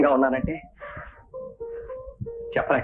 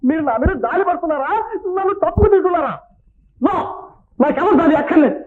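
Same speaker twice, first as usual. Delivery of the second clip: very high-pitched, wounded defiance; hysterical indignation. Acted. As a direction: refuse their pity in a frantic high cry. Manager angrily rejects colleagues' pity.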